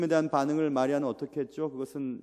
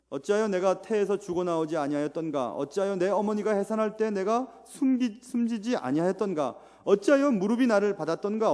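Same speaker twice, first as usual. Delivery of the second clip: louder, higher dramatic reading of Job; impassioned Bible reading; scripture lament reading; 4 semitones higher, 5 dB louder than usual. Acted. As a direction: read the lament with rising anguish. Reads Job's lament aloud.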